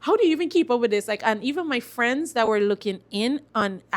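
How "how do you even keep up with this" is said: higher and quick, marvelling; amazed question. Retold or imitated. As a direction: ask it in quick disbelief. Quoting amazed people.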